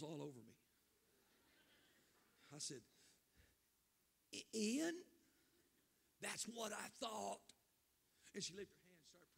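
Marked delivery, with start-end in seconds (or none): 4.3-5.0 high, louder stammered question; startled. Retold or imitated. Preacher startled by his sister's question, stammering. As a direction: catch your breath in shock.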